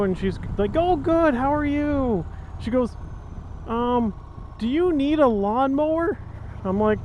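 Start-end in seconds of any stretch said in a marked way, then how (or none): 0.8-2.2 high, sweet neighbor lady. Retold or imitated. Neighbor quoted in story. 3.7-6.2 lady neighbor voice. Retold or imitated. Neighbor quoted in story.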